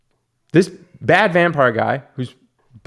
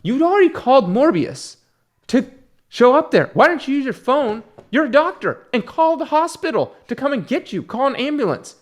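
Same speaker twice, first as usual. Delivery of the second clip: much higher, incredulous rant at the doctor; exasperated retort; 10 semitones higher than usual. Retold or imitated. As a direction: argue with the screen in disbelief. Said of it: "And I'm like".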